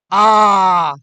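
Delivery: loud, wide-open आ; open vowel demo. Shown as a demonstration. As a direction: open the mouth fully on आ. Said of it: "पूरा मुख खुल रहा है ना". (पूरा मुँह खोलकर ज़ोर से आ)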